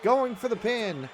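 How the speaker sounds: wrestling commentator. Acted. Live wrestling game play-by-play.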